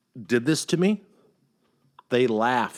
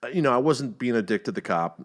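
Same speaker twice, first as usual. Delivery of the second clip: ordinary delivery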